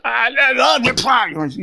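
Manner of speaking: high, slow, stammered, barely getting it out; choking on the words. Shown as a demonstration. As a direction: force "I, I love you" out as if it sticks in the throat. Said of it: "They got choke on the words".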